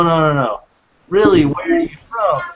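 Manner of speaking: normal talking voice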